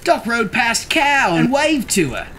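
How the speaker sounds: ordinary delivery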